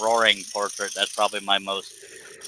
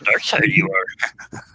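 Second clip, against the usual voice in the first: slow, raised sage creature voice; Yoda; much slower than usual. Pretend. Host joking with co-hosts as Yoda, slow impression. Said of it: "Do Yoda".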